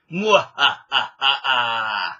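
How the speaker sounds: slow villainous cackle; mad scientist laugh. Pretend. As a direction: cackle like an evil genius. Evil laugh after plugging channel.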